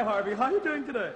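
normal talking voice

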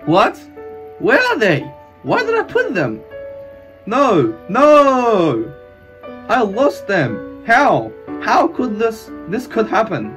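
high, loud panic; panicked disbelief. Acted. Hero missing his elixirs, panicked. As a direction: cry out as panic takes over.

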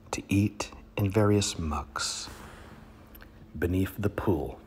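dramatic poetry reading with sudden swells; poem reading. Acted. Comic reader performing a poem to his audience, hammed up. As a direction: reads the duck poem with grand pauses.

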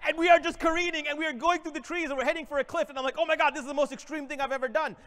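breathless adventure telling; excited. Acted. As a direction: build the thrill of careening toward a cliff. Thrilling cliff descent told.

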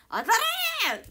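high, loud, slow cry of 当たれー; praying out loud for a win; drawn-out pleading shout. Real, not acted. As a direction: yell the wish out long and high. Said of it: "当たれ、お願いします".

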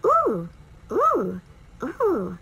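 ooh sung out high and loud, then easing; ooh sound. Shown as a demonstration. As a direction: model the ooh with lips pushed forward. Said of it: "the final sound is".

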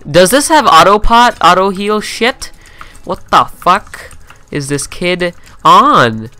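loud, high shouting rant, some words slowed; angry rant. Real, not acted. Gamer shouting at opponent who won't die, angry rant. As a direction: yell at him in outraged disbelief.

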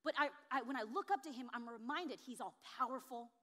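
usual speaking voice

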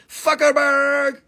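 Fuckerberg stretched out slow and high, like a chant; a slow, high group chant of Fuckerberg; said together with chat. Shown as a demonstration. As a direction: draw out Fuckerberg for the crowd. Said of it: "Let's say it together. Ready?".

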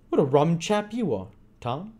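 put-on cheeky boy voice. A narrator's reading. Bates voiced in read story.

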